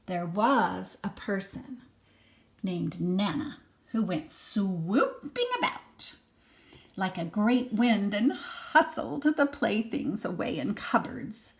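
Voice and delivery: put-on lively read-aloud for a small child; expressive storybook reading; story narration. A narrator's reading. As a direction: read it like a bedtime picture book. Reads picture book to child.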